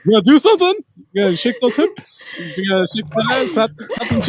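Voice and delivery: mocking taunt. Teasing mock taunts to partner.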